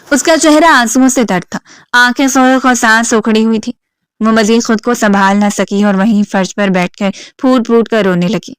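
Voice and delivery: ordinary delivery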